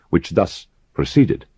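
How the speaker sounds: ordinary delivery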